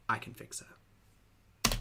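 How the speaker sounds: slow lovestruck whisper; smitten whisper. Pretend. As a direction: whisper it slowly like a devoted fan. Mocking smitten audience members.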